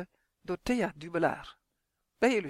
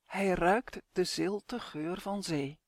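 usual speaking voice

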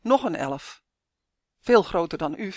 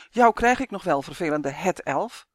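plain reading voice

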